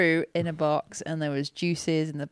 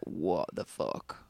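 low mock disbelief; 12 semitones lower, 7 dB quieter, slower than usual. Mock reaction in retold moment.

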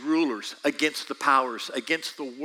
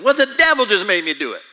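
louder, quick whiny excuse; mock excuse; 6 semitones higher, 9 dB louder, faster than usual. Preacher mocking a caught sinner's excuse, hammed-up. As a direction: blurt it like a caught kid.

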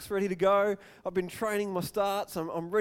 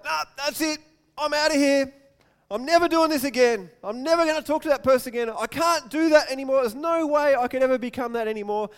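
higher and louder, storming off in a huff; fed-up outburst; 6 semitones higher, 7 dB louder than usual. Retold or imitated. Preacher voicing a hurt listener quitting, fed-up outburst. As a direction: throw up your hands and quit.

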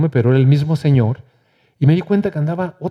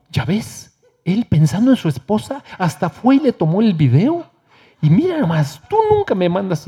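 mock scolding wife, slightly higher; 4 semitones higher than usual. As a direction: tease him like a nagging wife. Wife scolds husband, quoted. (esposa regañona, un poco más aguda)

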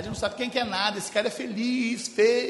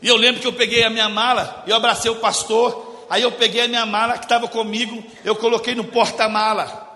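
loud building preach; 2 semitones higher, 8 dB louder than usual. Acted. (pregação alta e crescente)

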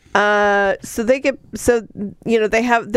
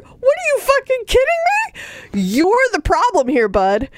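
incredulous retort; 13 semitones higher than usual. Retold or imitated. Retorting at the hero.

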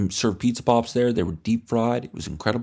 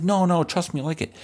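put-on pushy kid; 7 semitones higher than usual.

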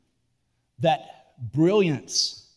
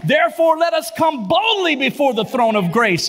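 loud, high, building sermon cry; preaching at full force; 10 semitones higher, 5 dB louder than usual. Acted.

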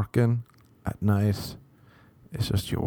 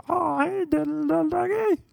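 put-on very high squeaky baby talk; cute pet-talk voice. Girl talking to her dog, squeaky cutesy voice. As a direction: gush at a tiny dog in falsetto. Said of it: "more like one of those cute".

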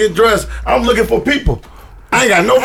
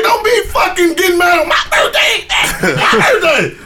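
mock angry yell; 7 semitones higher than usual. Retold yelling at girlfriend.

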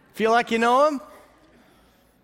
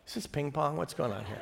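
puzzled goats; 5 semitones lower, 10 dB quieter, faster than usual. Retold or imitated. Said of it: "They're like".

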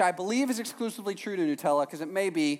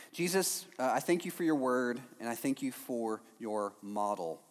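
soft, low prayer voice; hushed opening prayer to Jesus; 7 semitones lower, 7 dB quieter than usual. Real, not acted. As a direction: pray quietly and sincerely. Leads a prayer.

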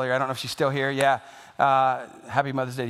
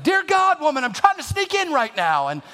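startled son; 9 semitones higher than usual. Retold or imitated. Son caught sneaking in past curfew, high startled yelp. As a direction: jump out of your skin and squeal at her. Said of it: "And it's like".

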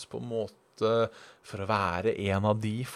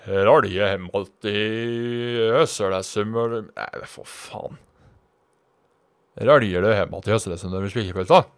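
Trøndelag accent attempt; 6 dB louder than usual. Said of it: "Midt-Norge".

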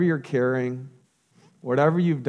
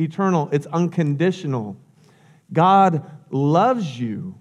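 louder, lifted preaching voice, slowing on key lines; fervent preaching; 3 semitones higher, 4 dB louder than usual. Acted. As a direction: preach it out to the congregation. Preacher builds to climax.

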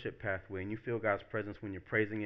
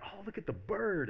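high-pitched, delighted exclamation; excited nature lover; 10 semitones higher than usual. Acted. Nature lover pointing out a bird to friends, excited. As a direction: gush over a sight in nature.

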